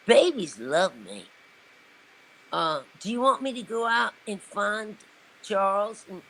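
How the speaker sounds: mild impression of a friendly Southern lady. Continuing [name]'s offer.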